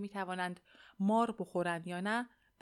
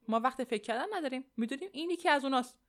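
fast, raised, pressing voice; urgent objection; 5 semitones higher, 4 dB louder, faster than usual. A narrator's reading. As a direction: push back hurriedly, higher and louder. Henry urgently argues against waiting. (با عجله و لحن معترض)

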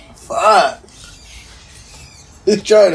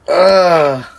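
ordinary talk, nothing marked

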